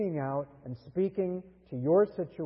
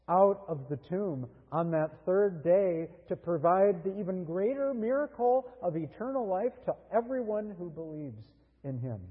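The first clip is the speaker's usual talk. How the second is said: normal talking voice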